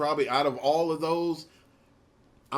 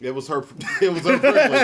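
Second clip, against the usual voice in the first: repeating it through laughter; cracking up mid-line; laughing; 7 semitones higher, 9 dB louder than usual. Real, not acted. Repeating punchline while laughing.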